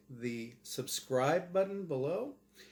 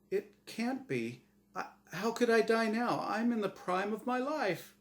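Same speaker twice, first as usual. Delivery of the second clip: grasp at denial; disbelieving Norm; 9 semitones higher than usual. Acted. Dead man protesting to the host, mild disbelief.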